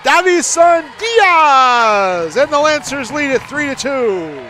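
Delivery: high, loud, slowly stretched goal call; long goal yell. Real, not acted. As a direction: hold the scorer's name out long. Commentator calls second goal.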